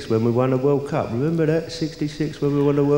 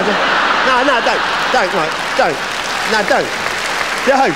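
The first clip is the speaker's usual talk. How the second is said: laughing plea; 8 semitones higher than usual. Real, not acted. Comic begging the punter on stage to stop. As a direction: beg him to stop, laughing.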